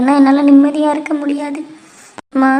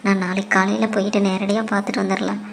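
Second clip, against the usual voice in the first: low, soft inner voice; inner thoughts; 6 semitones lower, 5 dB quieter than usual. Acted. Worried wife thinking about her mother, soft and low. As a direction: think it quietly to yourself, lower.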